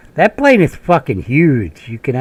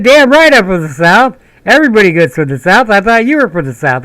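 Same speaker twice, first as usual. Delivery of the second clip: proud southern sergeant; 8 semitones higher, 6 dB louder than usual. Retold or imitated.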